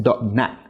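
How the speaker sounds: dot nat cut short and sharp; a sharp, dotted stop on nat; really sharp tone. Shown as a demonstration. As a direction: make nat land like a dot. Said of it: "Zonat is really sharp".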